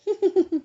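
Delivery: high-pitched, loud giggling; mischievous giggle. A narrator's reading. Little ones clattering out of the dormitory, mischievous giggle. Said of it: "giggling mischievously".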